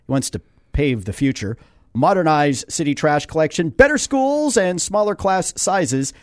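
steady radio ad read. Acted. Host reading the mayor's twelve-point plan, ad-read voice. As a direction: list the points like a sponsor spot.

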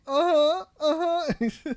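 mock small child agreeing; toddler voice. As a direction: whimper it like a sleepy toddler. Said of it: "He's like".

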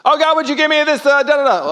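high, loud whiny petition; mock sock prayer. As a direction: parody a small-minded prayer. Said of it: "that's a sock prayer".

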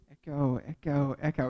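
low, softly repeating echo; imitated echo. Shown as a demonstration. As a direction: repeat it like a cave's bounce back. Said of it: "an echo. ... and it repeats".

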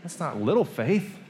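can you believe this; incredulous. Real, not acted. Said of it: "incredulous protest at Jesus".

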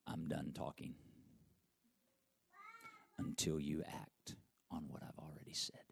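low, soft whisper; hushed whisper of God. Acted. Pastor voicing God's answer to him in a hush. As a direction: breathe God's words quietly.

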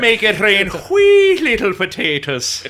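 loud, slow stage-Irish bellow; mock Irish shout. Sailors faking Irishness for pirates, shouted mock Irish brogue. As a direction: roar it in a hammy Irish accent.

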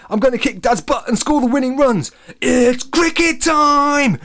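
put-on high, loud, singing boy's voice; excited shouting. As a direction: belt out a made-up tune, thrilled. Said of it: "Alex shouted excitedly".

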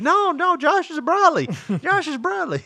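high-pitched frantic mock denial; panicked insisting. Covering up a lie to a caller, mock panic. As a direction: plead it way up high, hammed up.

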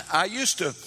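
ordinary talk

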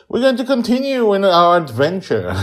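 high, loud showman opener; excited announcer. Acted. Host opening the episode to listeners, big excited announcer voice. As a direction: boom it out like a show host.

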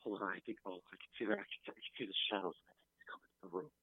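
fast, hushed panicked words; whisper yelling. Acted. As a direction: panic under the breath, fast. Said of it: "Why are you whispering?".

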